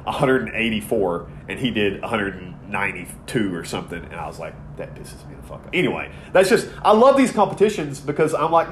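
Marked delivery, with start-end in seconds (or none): 4.8-5.7 breathy whisper, seething under the breath; fuming whisper; whispered fume. Retold or imitated. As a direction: hiss it out like a secret grudge. Angry quote of himself.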